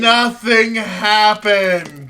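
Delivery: slow, loud, drawn-out disgust; loud exaggerated complaint. Real, not acted. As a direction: hammer each word out in protest. Exaggerated complaint about the movie.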